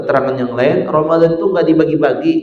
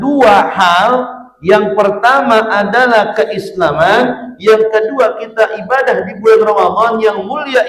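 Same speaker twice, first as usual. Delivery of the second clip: fiery preaching; 10 semitones higher, 7 dB louder than usual. Acted. Preacher rousing the congregation about Ramadan blessings, loud and high. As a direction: preach it out to the whole mosque, slowing on key words.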